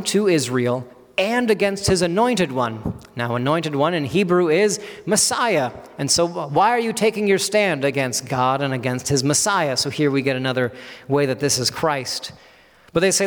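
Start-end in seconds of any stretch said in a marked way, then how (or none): none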